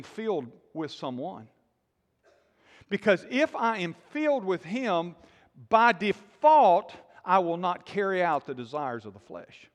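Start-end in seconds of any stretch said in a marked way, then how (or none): 5.7-6.9 "By default" slow and much louder, each word hit; stress on default. Acted. Preacher to congregation, hammering the point: loud, slow "By default". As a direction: punch "default" hard and slow.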